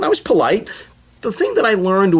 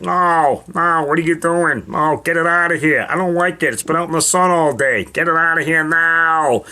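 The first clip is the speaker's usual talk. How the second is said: whiny comedian refusing the potato salad; mock Ray Romano griping about food; Ray Romano impression. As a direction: put on a comic's fussy complaint. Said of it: "he's like".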